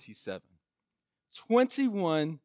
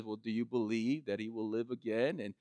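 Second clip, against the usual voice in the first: soft Jesus; 7 semitones lower, 12 dB quieter than usual. Retold or imitated. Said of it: "And Jesus says".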